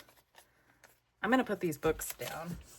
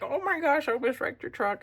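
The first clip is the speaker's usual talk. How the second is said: higher mock panic, imitating her husband; hammed-up husband, pitched up and alarmed; imitating panicked husband; 7 semitones higher, faster than usual. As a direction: play him freaking out over the near wreck. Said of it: "he was like".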